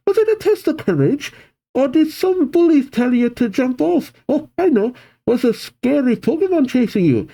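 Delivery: pepper them with questions in a high, put-on voice; Casey yelling. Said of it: "Suddenly Casey yells".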